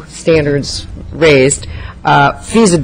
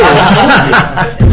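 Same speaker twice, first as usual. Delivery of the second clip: real laugh bursting out; burst of laughter; 3 semitones higher than usual. Real, not acted. Chair laughs at joke.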